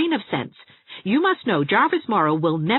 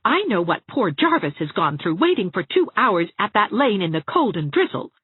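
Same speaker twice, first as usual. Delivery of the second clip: forceful, higher-pitched rebuke; merciless; 6 semitones higher, 5 dB louder than usual. A narrator's reading. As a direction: drive the reproach home without pity. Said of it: "said Anne mercilessly".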